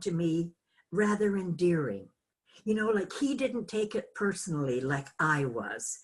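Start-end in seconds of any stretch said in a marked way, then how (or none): none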